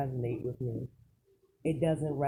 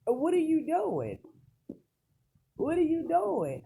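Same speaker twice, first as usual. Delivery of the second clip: high, loud incredulous outcry; What are you doing, thrown out in exasperation; exasperated disbelief; 10 semitones higher, 4 dB louder than usual. Real, not acted. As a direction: demand it in disbelief, pitch leaping up. Exasperated rant at public's focus.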